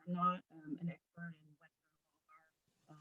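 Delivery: fading in and out on a bad mic; mic cutting out. Real, not acted. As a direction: voice dropping away as the mic cuts out. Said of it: "your mic is cutting out".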